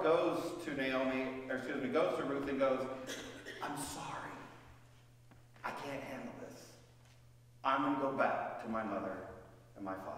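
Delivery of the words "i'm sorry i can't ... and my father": soft, quiet, nearly whispered lines; hushed apology. Acted. Orpah quietly telling Ruth she is leaving, hushed. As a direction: slip away with a quiet sorry.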